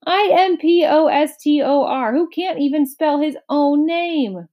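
high, frantic spelling-bee outburst; excited shout. A narrator's reading. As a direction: shriek the accusation letter by letter. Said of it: "shouted the bee very excitedly".

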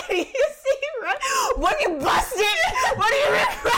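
high, loud laughing yell; laughing shout. Acted. Acting busted waitress, laughing shout.

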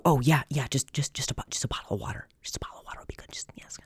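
whispered reply fading almost to nothing. Retold or imitated. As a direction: whisper back so the stage can't hear. Whispering back during the show.

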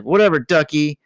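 huffy dismissal. Retold or imitated. Beverly storming off from Howard, huffy. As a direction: flounce off with it.

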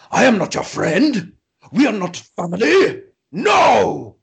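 high, loud retold bellowing; slow, loud mock scream; screaming actor. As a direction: imitate an indignant screamed refusal, word by word. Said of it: "he's just screaming".

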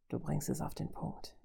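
soft, throaty villain undertone. A narrator's reading. Robot conceding the woman's point, throaty menace. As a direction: say it hushed, from the throat. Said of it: "sagte er kehlig".

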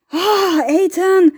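high, startled gasp at the cat; startled cry. Real, not acted. Speaker jumped on by the cat, startled. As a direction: cry out the name in shock.

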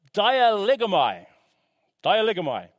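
DIALEGOMAI sounded out as a Greek word, falling at the end; Greek pronunciation. Shown as a demonstration. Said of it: "Sorry about my Greek pronunciation".

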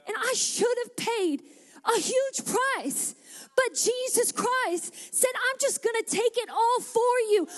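high-pitched, driving sermon climax; impassioned preaching. Acted. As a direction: preach it with rising fervour. Preaches to a crowd.

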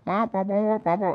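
mock wah-wah babble; Peanuts cartoon wah-wah. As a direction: imitate garbled cartoon grown-up chatter. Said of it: "the Peanuts cartoon".

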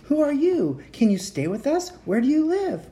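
high, eager little voices; excited kids all talking at once; children crowding visitors with questions; children's questions. Acted. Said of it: "asked questions all at once".

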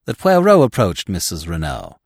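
plain reading voice

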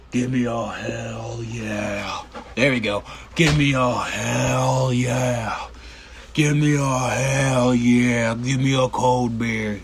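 lightly voiced slow Texas wrestler drawl; slow it down like a wrestling promo; Stone Cold Steve Austin. Podcaster tries his Stone Cold Steve Austin impression, slow drawl. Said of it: "a good Stone Cold impression".

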